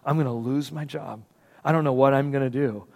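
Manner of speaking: hushed, defeated retelling of despair; low-volume, understated anguish; distraught. Retold or imitated. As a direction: imitate a distraught man, very softly. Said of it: "he was so distraught".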